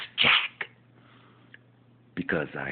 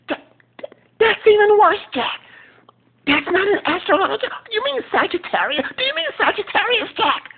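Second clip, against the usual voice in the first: much higher, louder exasperated shout; Will impression; 9 dB louder than usual. Will yelling at Jack about star signs, high and loud. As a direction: correct a clueless friend loudly. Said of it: "my impersonation of Will".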